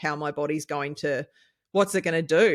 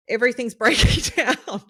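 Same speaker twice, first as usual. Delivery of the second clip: laughing; 7 semitones higher, 7 dB louder than usual. Real, not acted. Laughing about body breaking down.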